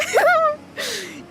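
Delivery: very high giggle; laugh. Real, not acted. Streamer amused by chat's remark, very high laugh.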